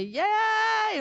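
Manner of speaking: high, loud drawn-out cheer; long, high-pitched "yay" of celebration; excited cheer. Retold or imitated. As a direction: imitate grandchildren cheering from the trailhead. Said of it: "they were so excited".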